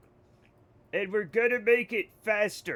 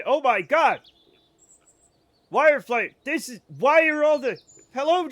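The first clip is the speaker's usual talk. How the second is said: excited; 7 semitones higher, 8 dB louder than usual. Real, not acted. Streamer greeted by many Germans.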